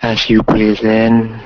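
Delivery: slow, calm meditation voice; guided meditation. Acted. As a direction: guide them gently through the breath. Guided ocean visualization.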